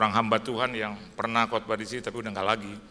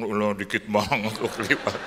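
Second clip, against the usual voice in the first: indignant; 6 dB louder than usual. Retold or imitated. Indignant at small discount.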